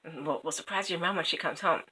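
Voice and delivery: fast, soft whispered plotting; conspiratorial whisper. Acted. The king whispering to the family to hide for a surprise. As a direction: hush them into hiding. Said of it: "the king says".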